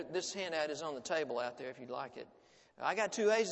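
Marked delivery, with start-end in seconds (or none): 2.8-3.5 put-on bright, loud boyish cry of glee; gleeful cry. A narrator's reading. Small boy boasting to his father, gleeful loud cry. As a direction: announce it like a proud little boy. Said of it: "a small boy cried".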